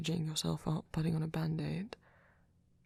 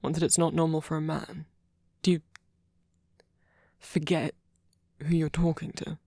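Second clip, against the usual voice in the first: louder, halting indignant challenge; defensive and angry; 5 dB louder than usual. Acted. Challenging a partner's self-deprecating remark, defensive and angry. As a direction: push back firmly, with pauses between phrases. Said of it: "get defensive and angry".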